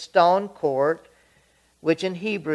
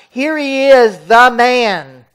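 loud announcement; 8 semitones higher, 13 dB louder than usual. Acted. Pilate to the crowd, loud announcing. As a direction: proclaim it loudly, higher, to the crowd. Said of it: "And Pilate announced".

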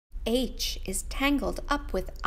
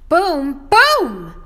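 higher, much louder boom boom; booming refrain; 5 semitones higher, 13 dB louder than usual. Acted. Teacher booming the refrain for the kids. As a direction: let the booms explode.

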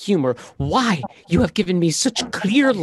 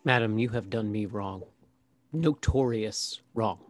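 bitter hushed grievance; 6 semitones lower, 9 dB quieter than usual. Acted. Wronged Malvolio confronts Olivia.

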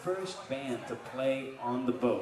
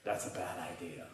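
lightly voiced sound guy; 8 semitones lower, 10 dB quieter than usual. Sound guy warning the comedian, low and quiet. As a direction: lower and softer, a dry deadpan warning. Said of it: "and he goes".

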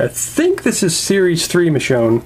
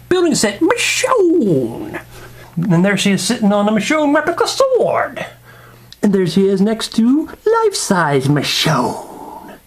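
high, drawn-out mock-grand roll-call; over-the-top announcer; 6 semitones higher, slower than usual. Reviewer parading figure collection, hammed-up announcing. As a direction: swoop up and hold each name like a hype man.

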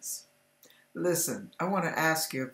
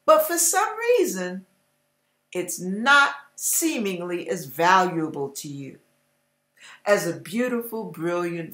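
louder, driving sermon delivery; preaching; 3 semitones higher, 4 dB louder than usual. Acted. Preacher challenging listeners who walk away from God, forceful preaching. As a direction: hammer the question to the flock.

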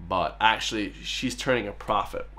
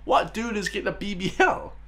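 incredulous question; 9 semitones higher than usual. Real, not acted.

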